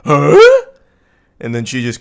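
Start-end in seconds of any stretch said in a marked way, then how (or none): 0.0-0.4 a loud, lightly voiced sitcom-dad grunt of confusion; Tim the Toolman Taylor. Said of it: "like Tim the Toolman Taylor".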